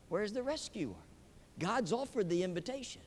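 ordinary delivery

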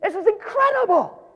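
high, marvelling cry; amazed exclamation. Real, not acted. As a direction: savor the wonder. Preacher marvelling aloud.

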